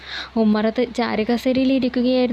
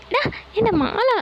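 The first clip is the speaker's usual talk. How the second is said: much higher sudden outcry; alarmed cry; 11 semitones higher than usual. Acted. Hotel owner crying out as Sugunan grabs the garland, much higher alarmed yelp. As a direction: yelp as if your garland is being snatched.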